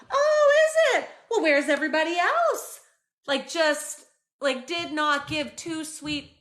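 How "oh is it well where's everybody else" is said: dismissive mom. Retold or imitated. Mom dismissing son's gift.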